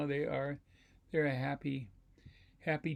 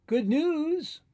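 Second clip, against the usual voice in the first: high, loud squeal of news; excited rabbit; 12 semitones higher, 9 dB louder than usual. Acted. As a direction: shout it with glee. Rabbit cheers.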